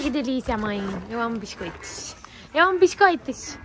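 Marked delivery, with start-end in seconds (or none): 1.1-2.1 soft voicing. Owner voicing her horse's love of biscuits, quiet murmur. 2.5-3.6 cute horse voice. Pretend. Owner voicing her horse's love of biscuits, high cute voice.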